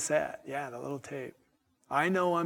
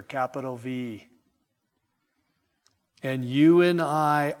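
slow, measured pulpit declaration with loud attacks; emphatic preaching; 6 dB louder, slower than usual. Acted. Preacher telling the congregation of God's victory, slow emphatic preaching. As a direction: drive each key word home, then ease off.

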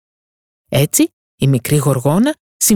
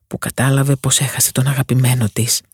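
ordinary delivery, nothing marked

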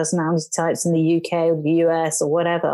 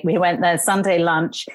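ordinary talk, nothing marked

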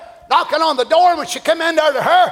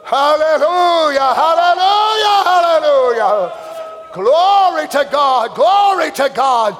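ecstatic praise; slower than usual. Acted. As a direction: let the praise swell out slowly.

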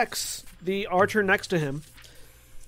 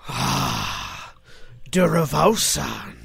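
a hint of a big orc; slow orc growl, barely changed; lightly voiced orc; 3 semitones lower, 3 dB louder, much slower than usual. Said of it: "the large orc goes".

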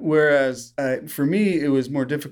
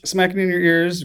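ordinary talk, nothing marked

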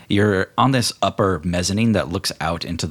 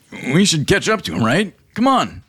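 higher, eager tipsy urging; tipsy Dave; 10 semitones higher, 3 dB louder than usual. Acted. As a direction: push his friend to hurry after him. GM voices drunken Dave.

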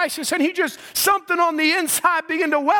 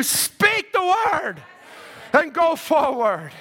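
preacher's climactic cry; slower than usual. Real, not acted. Climax of exhortation, called out slowly.

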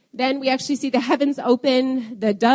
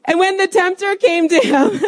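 laughing; 8 semitones higher, 6 dB louder than usual. Real, not acted. Resumes reading while still laughing.

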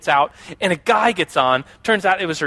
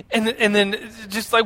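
normal talking voice

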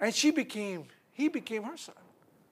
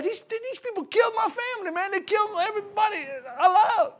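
high, strained cry of a man who lost his family; aggrieved Jonah protesting to God, high and strained; voicing a bitter Jonah; 9 semitones higher than usual. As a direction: pitch it up high, aggrieved and hurt, half laughing. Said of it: "what if you felt like Jonah".

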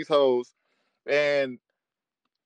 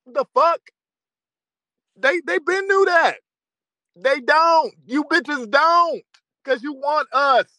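higher and louder, indignant; heated, raised-voice rant; angry outburst; 8 semitones higher, 5 dB louder than usual. Real, not acted. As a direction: blow up in disbelief.

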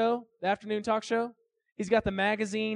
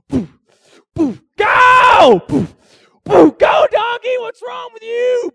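high, loud angry shouting at a stubborn animal; furious yelling at donkey; 14 semitones higher, 14 dB louder, slower than usual. Acted. Balaam yelling at his stubborn donkey, furious shout. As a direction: yell and whack, frantic.

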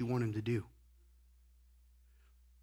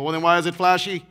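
mocking objector; 3 semitones higher, 10 dB louder than usual. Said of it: "And some people go".

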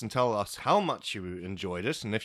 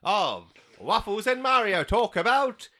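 mock announcer; 9 semitones higher than usual. Show sign-off announcer.

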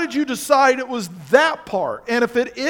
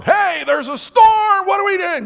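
hammed-up panic of frightened disciples; panicked shout; 11 semitones higher, 7 dB louder than usual. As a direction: yell out in mock panic at the storm.

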